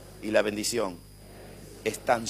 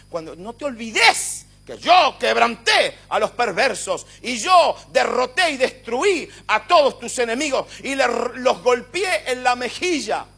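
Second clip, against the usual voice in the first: booming, higher, slow and shouted; thundering God; 9 semitones higher, 12 dB louder than usual. Acted. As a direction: roar it as God reminding David of victory. God voiced triumphant to David.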